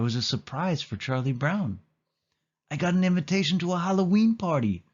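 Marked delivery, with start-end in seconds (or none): 2.7-4.8 high, fast and eager; a kid thrilled by an invitation; excited exclaim. A narrator's reading. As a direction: burst out with the news. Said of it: "he exclaimed".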